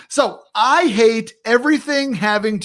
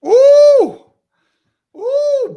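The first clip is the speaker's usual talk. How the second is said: long ooh, far higher and louder; shocked ooh; 8 dB louder than usual. Real, not acted. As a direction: let the shock burst out in a high ooh. Host reacts to hot take.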